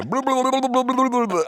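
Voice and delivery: high gurgling blub noises; bubbling sounds. Retold or imitated. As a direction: gurgle like someone under water. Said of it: "And you just hear".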